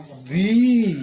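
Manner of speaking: "बी" stretched slowly. Shown as a demonstration. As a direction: stretch "बी" so the long ई is clear. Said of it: "और धीरे कहो".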